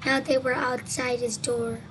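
ordinary delivery, nothing marked

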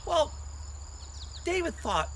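usual speaking voice

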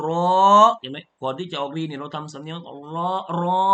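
ro stretched out slowly with a tone; drawn-out ro. Shown as a demonstration. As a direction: hold the รอ with a chanting tone before the next syllable. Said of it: "เราทำสำเนียงไปด้วย".

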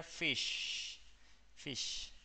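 "fish" slowly, ending on a long sh; clear final sh. Shown as a demonstration. Teacher joins the sound to the word, "fish" with drawn-out sh. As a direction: draw out the "sh" closing "fish". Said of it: "si lo juntás con la palabra, sería".

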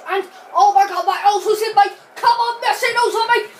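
high, loud running commentary; excited play-by-play. Real, not acted. Player commentates own attack with Ozil, real excitement. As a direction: shout the attack as it happens.

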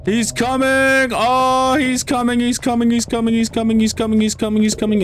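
frantic high repeated warning, voice cracking up; chased by a monster, panicking over and over; panicked high yelling. Real, not acted. Gamer chased by a monster in the dark, panicked high yelling. Monster chasing player in game.

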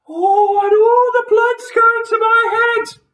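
very high, loud wail; panicked shriek. A narrator's reading. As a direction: scream it as a frantic Missus Twit. Missus Twit panics upside down.

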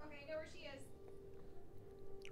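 hushed, breathy whisper. Real, not acted. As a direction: whisper so the pet sleeps. Sneaking near sleeping pet.

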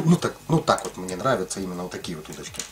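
ordinary talk

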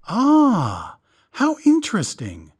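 high, gushing show of interest; mock enthusiasm for the angry visitor; vast enthusiasm. A narrator's reading. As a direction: overplay the delight. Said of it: "put vast enthusiasm into his voice".

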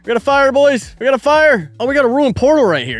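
high, loud urgent excitement; excited alarm. Real, not acted. Spotting a fire and portal.

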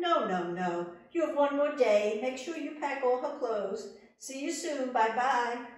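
high, loud, taunting farewell; little man mocking the queen; gloating little man. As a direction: gloat and wave her off.

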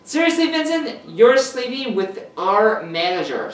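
high, loud, scandalised shout; yelling. A narrator's reading. Caden finding out Vinny slept with the manager, yelling. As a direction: shriek it in outraged disbelief. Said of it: "starts to yell at him".

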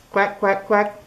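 a gentle little quack hello; mild duck quack. Acted. Duck greets pigs.

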